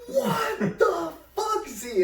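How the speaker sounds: very high, drawn-out shocked cry; imitating a stunned crowd; mock outrage. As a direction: squeal it slowly in disbelief. Shocked crowd reacting to prank.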